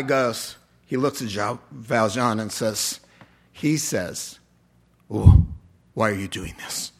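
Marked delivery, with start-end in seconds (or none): 5.1-5.5 a sudden loud low "Oh"; startled oh. Retold or imitated. Voicing the stunned man before his question, loud and low. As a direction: blurt it out in shock. Valjean's captor voiced in story.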